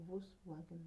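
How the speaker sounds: Volkswagen tested softly as "vos-wagen"; trying out pronunciation. Shown as a demonstration. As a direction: murmur Volkswagen to yourself, recalling how it goes. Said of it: "How do you pronounce it before?".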